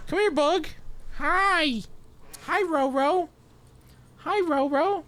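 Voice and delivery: high sing-song pet voice. Real, not acted. Greeting his dog Bug.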